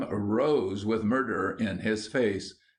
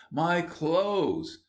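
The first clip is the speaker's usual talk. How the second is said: high, adoring exclamation; radiant joy; 8 semitones higher than usual. A narrator's reading. William sees his suit at last, overjoyed. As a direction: greet a long-lost friend. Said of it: "a radiant look as of one beholding some dear friend".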